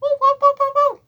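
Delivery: shrill cartoon mouse cry; squeaky Mickey Mouse woos; high-pitched mouse. Shown as a demonstration. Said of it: "do him high-pitched".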